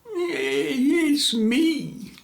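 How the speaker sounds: high, strained tree voice with a cry in it; creaky tree voice. A narrator's reading. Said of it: "said the tree".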